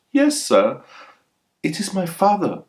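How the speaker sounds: put-on high, light girl's reply; young girl's voice. Young Champinaka answers the stranger, in a high girlish voice. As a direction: answer like a shy young daughter.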